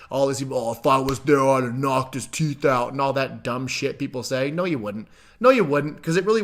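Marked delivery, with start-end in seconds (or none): 0.6-2.9 lightly put-on tough-guy voice; mock tough talk. Mocking people who say they'd have fought, pretend bravado. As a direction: imitate a blustering would-be defender. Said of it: "this whole pretend thing".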